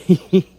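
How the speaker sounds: short loud high laugh; laugh, pleased; Duchess's pleased chuckle. A narrator's reading. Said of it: "in a pleased tone".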